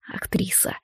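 whisper. Acted. Alex's cynical inner thought.

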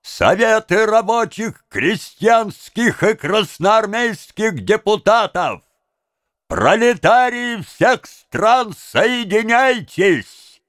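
high, stretched-out proclamation; ringing, high-pitched rallying call; orator's slogans. A narrator's reading. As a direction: declaim it slowly to a huge rally. Orator shouts slogans to the crowd.

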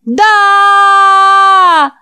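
very high, loud shout of yes; crowd shouts yes. Acted. The boys roar yes at the preacher. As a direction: roar back at full voice.

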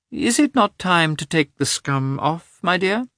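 a hint of a chatty old lady; old princess. A narrator's reading. Princess addressing Agafya.

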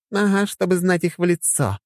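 lightly ominous woman's voice; a hint of menace; sinister wife. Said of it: "зловеще подсказала Хельга". (зловеще)

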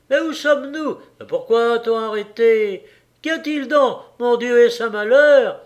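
high, loud, anxious questions; alarmed cries. Acted. Passengers crying out from the windows, high alarmed calls. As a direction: fret aloud at the stuck train. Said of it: "On criait, on questionnait".

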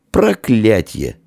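high, drawn-out curse; exasperated, stretched exclamation; cursing. A narrator's reading. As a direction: spit out a curse in frustration. Curse in acted drunken scene.

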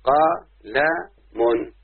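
"قلم" stretched very long; drawn-out word. Shown as a demonstration. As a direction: hold the word out for a child. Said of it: "أهو".

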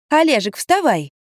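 cheerful wake-up call. Acted. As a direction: rouse him like a nanny. Nanny wakes the man.

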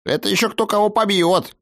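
high-pitched, huffy retort; offended halfling. A narrator's reading. Halfling taking offence at the threat of a beating, huffy. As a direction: answer back in a piping, indignant voice. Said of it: "обиделся Робин".

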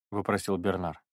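ordinary delivery

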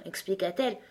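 ordinary delivery

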